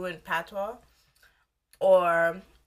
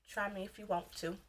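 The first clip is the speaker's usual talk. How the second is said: much softer repeat of the dare; quiet warning; 10 dB quieter than usual. Real, not acted. Warning commenters who write stupid stuff, very quietly. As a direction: say the dare under your breath.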